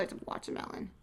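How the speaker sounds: whispered to herself, breathy and unvoiced. Real, not acted. Vlogger whispering the bag's flavor to herself, whispered. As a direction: hush it like a little secret. Aside to herself about bag.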